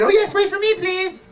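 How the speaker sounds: very high, pleading fairy. Acted. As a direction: call after them in a high fairy voice. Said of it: "The fairy said".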